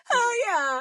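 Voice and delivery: loud, high-pitched playful cheer; mock-excited yell; eager whoop. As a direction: jump at the offer, hammed-up. Acting out eager response to bait.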